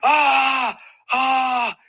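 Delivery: high, loud cries of pain; pained yells. Retold or imitated. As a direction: scream twice as if hit. Reenacting the jaw breaking.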